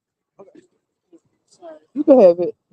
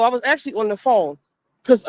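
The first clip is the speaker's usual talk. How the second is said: ordinary talk, nothing marked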